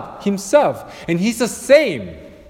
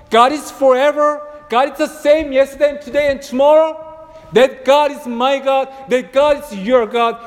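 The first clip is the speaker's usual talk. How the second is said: rising preaching; 6 semitones higher than usual. Acted. Preacher to the congregation on God's faithfulness, building proclamation.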